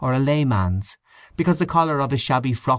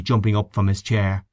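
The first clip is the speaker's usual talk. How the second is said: plain reading voice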